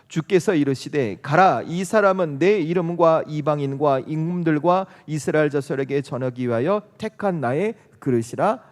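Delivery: slow, loud unison reading of scripture; measured, emphatic Bible recitation. Acted. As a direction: read the verse out slowly and firmly together. Congregation reads scripture aloud together.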